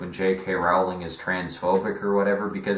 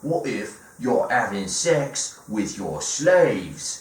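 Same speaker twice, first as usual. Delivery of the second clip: mock British accent; 2 semitones higher than usual. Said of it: "[name] is like".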